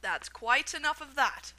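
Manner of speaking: loud, slow firm shout. A narrator's reading. Shopkeeper shouting the crowd down, loud and firm. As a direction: shut the crowd down. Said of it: "the fat shopkeeper shouted".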